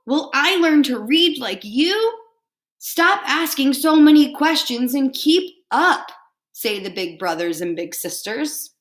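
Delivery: lively picture-book read-aloud; reading to children. Acted. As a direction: read it out warmly to a class.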